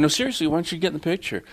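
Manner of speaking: insistent. Retold or imitated. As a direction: press him to join, quickly. Insisting officer join photo.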